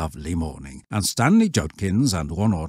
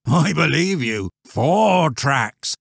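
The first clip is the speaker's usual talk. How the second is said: loud, raised rustic voice; emphatic old shepherd; 9 semitones higher, 5 dB louder, slower than usual. Acted. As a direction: countryman confirming darkly. Shepherd echoing boy's word.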